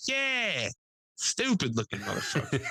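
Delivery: high quote through laughter; laughing. Retold or imitated. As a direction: laugh as you say it. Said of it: "He's like".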